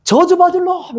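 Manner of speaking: high-pitched imitated curse; mock mob shout. Crowd keeps cursing Paul on the stretcher, mock shouting. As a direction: hurl it like a jeering crowd member. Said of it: "이렇게 막 계속 사람들은".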